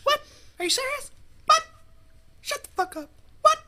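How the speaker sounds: high squealing disbelief; tearful squealing yes. Retold or imitated. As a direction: shriek it in happy shock. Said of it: "and she was just like".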